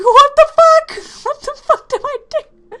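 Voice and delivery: very high, very loud frantic wail; mock a teacher losing it completely; teacher shrieks helplessly at the fight; panicked teacher.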